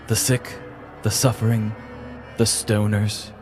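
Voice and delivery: ordinary talk, nothing marked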